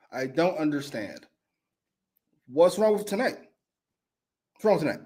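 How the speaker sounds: usual speaking voice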